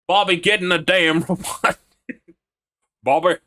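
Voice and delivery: put-on high, loud southern US accent. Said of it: "whenever I try to do the southern voice".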